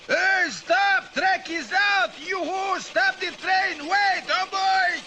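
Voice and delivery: yelling to stop the train; shouting. Acted. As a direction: shout it out loud.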